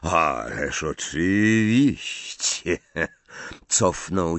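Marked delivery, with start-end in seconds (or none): 0.0-2.8 slow Snape drawl. Acted. Snape answers at the door.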